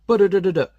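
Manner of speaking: spray the bullets with your mouth; machine gun noise. Retold or imitated. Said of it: "It's like".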